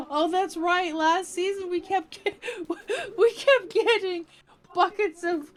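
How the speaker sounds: words bubbling through laughter; laughing. Real, not acted. Streamer laughing about buckets of fishies, giggling. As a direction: laugh while remembering. Laughing remembering puffer fish.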